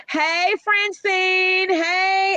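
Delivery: high, drawn-out hello; excited greeting. Real, not acted. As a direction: greet a friend with delight. Greeting viewers in comments.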